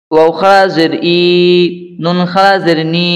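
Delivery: measured chant-like drill with long final vowels; spelling drill recitation. Shown as a demonstration. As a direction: recite each spelling evenly, drawing out the last syllable. Leads a letter-by-letter recitation drill.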